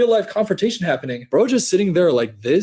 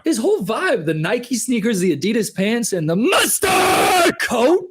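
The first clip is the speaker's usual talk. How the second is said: building incredulous shout; 7 semitones higher than usual. Real, not acted. Streamer roasting the guy's outfit, rising to a shout.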